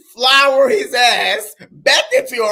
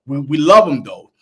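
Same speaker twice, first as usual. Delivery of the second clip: normal talking voice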